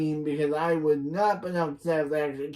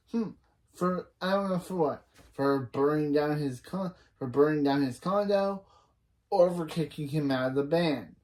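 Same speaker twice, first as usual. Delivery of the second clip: usual speaking voice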